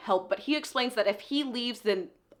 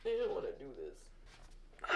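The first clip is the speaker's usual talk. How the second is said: much softer, lower, slow and tearful, with a sigh; real crying; 8 semitones lower, 12 dB quieter than usual. Real, not acted. Reader admitting to viewers she is crying, tearful. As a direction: talk through real tears, barely holding on. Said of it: "I cried the first time I read this scene".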